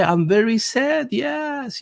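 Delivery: slow, high mock German accent; mimicking Schwab in a German accent; mock Klaus Schwab. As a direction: whine it in a put-on German accent. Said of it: "Il dit, ouais".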